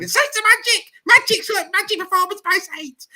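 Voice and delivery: very high, loud, squealing fan voice; giddy fan impression. Host retells the giddy fan after Salford, squealing high. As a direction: gush it like a giddy supporter. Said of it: "a Saints fan after the Salford game who got a bit giddy saying".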